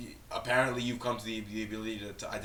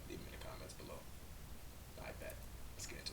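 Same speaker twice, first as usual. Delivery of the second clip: whisper; 5 semitones lower, 17 dB quieter, much faster than usual. Real, not acted. Whispered intro before the reaction.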